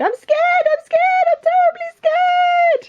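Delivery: very high repeated squeal, stretching at the end; terrified cook. Acted. Cook declaring his fear to the king, squealing in terror. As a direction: build a hammed-up wail of fear.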